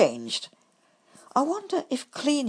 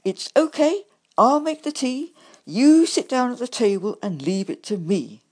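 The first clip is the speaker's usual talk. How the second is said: loud, higher, bossy insistence; insistent; 6 semitones higher, 7 dB louder than usual. A narrator's reading. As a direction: take charge over the badger. Rat insists, still irritated.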